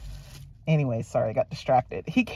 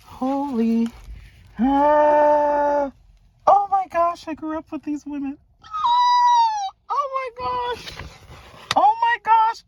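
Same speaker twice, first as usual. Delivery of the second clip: high, gushing delight; high gush of joy; thrilled squeal; 13 semitones higher, slower than usual. Real, not acted. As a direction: squeal over the catalog. Nostalgic over childhood supermodels.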